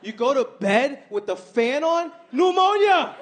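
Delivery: shouted high and loud as a doom warning; a sudden loud high yelp; mock mom warning. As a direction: blurt it like a panicked mom. Mom's warning retold.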